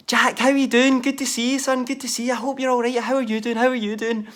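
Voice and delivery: high, sweet, overly polite mum voice; mum being nice. Retold or imitated. Mum greeting Jack sweetly straight after screaming, high polite voice. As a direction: switch to a sugary welcome. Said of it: "Straight from that pure".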